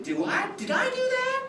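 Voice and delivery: very high, squeaky-pitched disbelief; mock astonishment. Clown to laughing kids over broken prop, mock astonished. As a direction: act shocked at what you just did.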